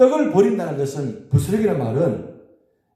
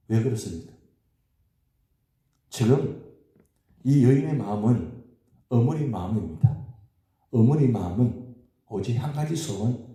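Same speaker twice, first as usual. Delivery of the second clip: ordinary talk, nothing marked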